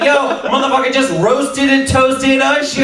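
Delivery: high-pitched amazed crowing; excited hype man. Acted. Laughing entourage member hypes the roast.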